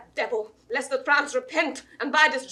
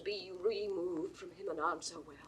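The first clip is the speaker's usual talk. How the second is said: much softer, urgent pleading; pleading hush; 5 semitones lower, 14 dB quieter than usual. Acted. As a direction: drop to an intense, quiet plea. Constance pleads with the king.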